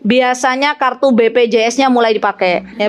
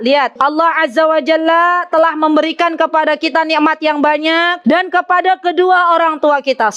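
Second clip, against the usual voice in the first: ordinary talk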